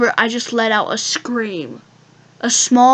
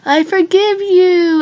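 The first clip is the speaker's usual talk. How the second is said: high, slow, sugary "I forgive you"; sugary forgiveness that doesn't mean it; fake sweet forgiveness; 7 semitones higher, 5 dB louder, slower than usual. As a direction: pretend to forgive with a fake smile. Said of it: "my best smi-, fake smile".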